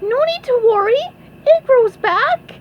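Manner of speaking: high, loud squeaky reassurance; SpongeBob yelling. A narrator's reading. SpongeBob calls to fleeing guests, high loud yell. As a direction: call out cheerfully in a cartoon squeak. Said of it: "yelled SpongeBob".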